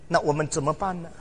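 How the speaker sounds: normal talking voice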